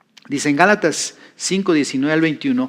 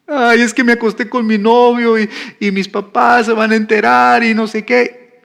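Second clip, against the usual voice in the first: higher, louder whiny confession; girl confessing to the pastor, weepy imitation; tearful girl; 7 semitones higher, 5 dB louder than usual. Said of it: "empezó a llorar".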